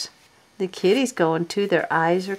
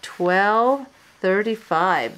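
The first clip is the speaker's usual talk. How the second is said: ordinary delivery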